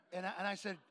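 ordinary talk

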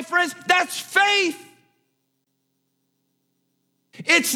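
high, loud, driving preacher; fervent preaching. Acted. Preacher urging the congregation, loud and fervent. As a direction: lift the church with certainty.

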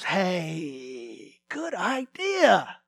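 soft, slow, offhand approval; lightly voiced careless master; lazy boss. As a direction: shrug it off as a good idea. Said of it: "he goes".

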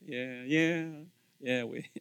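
soft, dismissive sarcastic yeahs. Shown as a demonstration. Preacher repeating the sarcastic yes, softly dismissive. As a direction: brush it off, eye-rolling. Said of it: "Ever done that one?".

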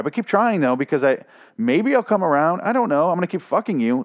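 put-on high, light girlish voice; a hint of a coy teenage girl; mock Lydia. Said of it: "So she's just trying to be like".

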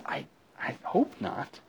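high, soft, slow and hesitant; mock worry. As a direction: play it small and high, hoping uncertainly. Acted worry at a hidden curse.

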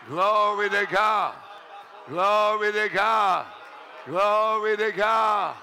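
slow, drawn-out praise shout; repeated revival-style acclamation; fervent praise. Real, not acted. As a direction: proclaim it slowly with full force.

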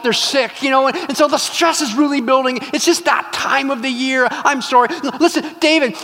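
high, flustered pleading; excuse-making wife. Acted. Wife makes excuses to David for Nabal, high flustered pleading. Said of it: "is to make excuses".

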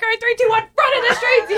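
very high, loud countdown into a rallying cry; retold yelling. Film-maker cueing extras to run, retold excited yell. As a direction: re-enact sending a crowd off, half laughing. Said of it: "I'm literally yelling".

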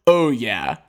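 low, dry, clipped reply; a hint of a smug Pope; deadpan cold. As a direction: say it low and unbothered. Pope answering coldly.

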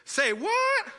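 high, loud, disbelieving outburst; comic exclamation. Acted. Preacher jokes his own version of the kings' reaction, exclaiming in shock. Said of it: "they exclaimed".

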